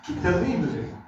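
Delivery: ordinary delivery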